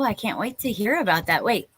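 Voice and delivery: ordinary delivery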